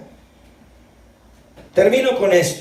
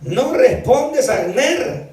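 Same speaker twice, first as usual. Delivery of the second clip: calling out; 6 semitones higher, much slower than usual. A narrator's reading. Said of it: "empezó a gritar David".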